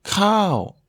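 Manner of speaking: "Krao" high then falling. Shown as a demonstration. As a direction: drop the pitch through "Krao". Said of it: "un ton descendant".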